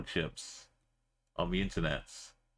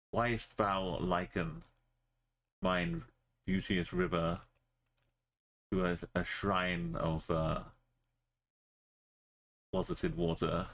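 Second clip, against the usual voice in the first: hammed-up old theatrical recital, slowed; mock sonnet reading; mock Shakespearean; 2 semitones lower than usual. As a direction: recite like a bad Elizabethan actor. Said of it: "read Shakespeare's sonnets".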